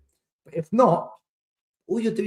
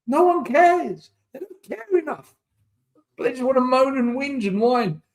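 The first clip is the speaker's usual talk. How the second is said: pitched up high, exasperated rant; 8 semitones higher than usual. Real, not acted. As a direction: throw your hands up in frustration.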